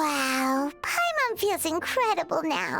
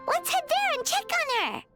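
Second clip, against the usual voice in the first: high, excited rallying call; eager suggestion; 9 semitones higher than usual. Acted. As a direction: propose it excitedly, pitched right up. Eager idea to go find friend.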